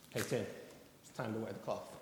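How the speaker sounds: low, soft calling voice; a lightly voiced gentle divine call; God's voice. God calling the pastor to ministry, low soft voice.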